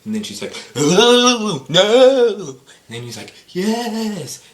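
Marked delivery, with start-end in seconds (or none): 0.8-2.6 high, loud drawn-out mock wail; acted wailing no. Acted outcry of movie character. 3.5-4.4 high, loud drawn-out cry; hammed-up yes. Acted reply of movie character.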